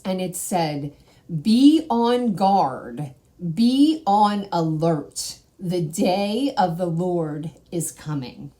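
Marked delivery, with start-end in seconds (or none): 1.3-8.5 slow, loud, solemn pronouncement; solemn divine voice. Retold or imitated. A voice from God warns her at night, slow solemn proclamation. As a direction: proclaim it slowly like a warning from above. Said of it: "I heard another voice".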